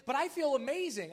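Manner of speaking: high, loud and gushing; giddy insistence. Retold or imitated. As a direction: insist you feel amazing. Sarah insisting, elated.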